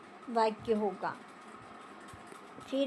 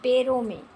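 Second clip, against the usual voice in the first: पैरों में punched louder; teacher correcting with पैरों stressed; 6 dB louder than usual. Shown as a demonstration. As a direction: hit पैरों as the right word. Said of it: "गले में नहीं".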